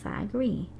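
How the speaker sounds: ordinary delivery, nothing marked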